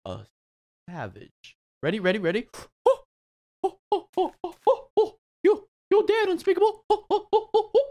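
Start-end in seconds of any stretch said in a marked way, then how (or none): 3.6-7.9 high laughing oh-oh-oh; shocked laughter. Real, not acted. Mobs crushed, screaming laughter.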